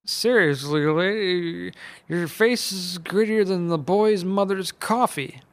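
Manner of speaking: cranky uncle mocking a witch's face; taunting Uncle Seamus. A narrator's reading. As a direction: rub the insult in. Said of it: "Uncle Seamus continued his taunting".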